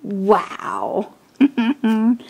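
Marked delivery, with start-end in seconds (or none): none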